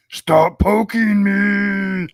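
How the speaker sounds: drawn-out whiny shout; whiny yell. Acted. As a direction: whine it out loud and slow. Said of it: "you were talking about the stop poking me".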